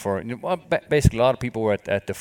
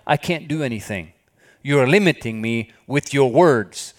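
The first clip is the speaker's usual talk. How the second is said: slow, rising, louder divine rebuke; God speaking to a worrier, slow and mounting; 5 semitones higher, 6 dB louder, slower than usual. Retold or imitated. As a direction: deliver God's words with building force. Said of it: "what was he saying? God said".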